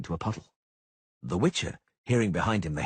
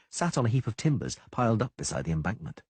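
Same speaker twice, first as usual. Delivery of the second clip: usual speaking voice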